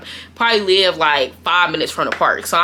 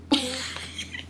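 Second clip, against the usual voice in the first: laugh; 8 semitones higher, 12 dB quieter than usual. Real, not acted. Laughing at own story.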